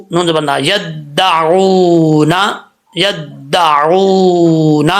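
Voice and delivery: يدعون drawn out very slowly; teacher drawing out یدعون; slow spelled recitation. Shown as a demonstration. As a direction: stretch یدعون with a long و. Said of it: "عین واو پیش عو".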